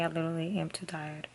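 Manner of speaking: soft unvoiced whisper; library whisper. Real, not acted. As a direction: whisper so you don't wake anyone. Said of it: "I'm whispering because people are sleeping".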